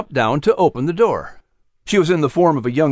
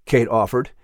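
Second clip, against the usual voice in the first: ordinary delivery, nothing marked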